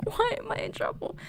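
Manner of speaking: put-on high, breathless little-kid whisper; imitating her frightened younger self, whispered; scared whisper. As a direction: sound tiny and scared.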